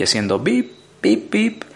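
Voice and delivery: high robotic bip bip bip; mock beeping. Ford mocking aliens with antennae, high beeping. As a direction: squeak out the beeps like a toy alien.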